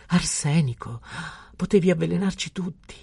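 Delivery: high, gasping alarm; horrified cry. A narrator's reading. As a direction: wail it as a terrified mother. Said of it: "gridò la signora Homais congiungendo le mani". (grido di orrore, acuto)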